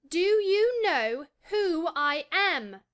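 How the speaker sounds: high, slow, drawn-out haughty demand; Colin, utterly imperious. A narrator's reading. Boy demanding an answer from silent gardener, very imperious. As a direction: stretch each word out imperiously, pitched high. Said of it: "still more imperiously".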